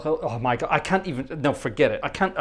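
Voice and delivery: normal talking voice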